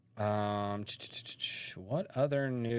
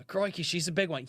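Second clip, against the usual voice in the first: Steve Irwin impression; 8 semitones higher than usual. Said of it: "Steve Irwin bit".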